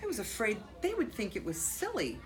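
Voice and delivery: expressive read-aloud with swelling key words; animated picture-book reading with deliberate pauses; story narration. A narrator's reading. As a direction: read to children, punching the important words. Reads picture book aloud to children.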